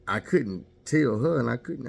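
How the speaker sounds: ordinary talk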